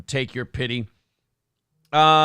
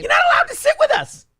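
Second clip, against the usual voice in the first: mocking high voice; 4 dB louder, faster than usual. Mocking Mean Girls quote.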